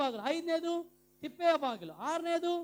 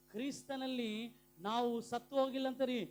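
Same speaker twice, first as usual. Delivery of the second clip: ordinary talk, nothing marked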